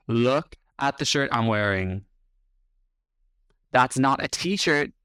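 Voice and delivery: normal talking voice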